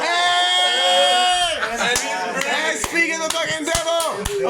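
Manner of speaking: loud, high, drawn-out greeting shout; excited hail. Real, not acted. Host greeting James as he walks in, high excited shout. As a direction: holler a delighted welcome across the room. Hailing the friend who just arrived.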